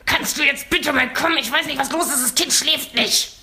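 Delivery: mock exasperated husband, high and slow, forced through the teeth; pressed through teeth. Husband at one in the morning, baby on his arm, pleading through his teeth. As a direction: play the fed-up husband, squeezing each word out. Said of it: "und presst durch die Zähne".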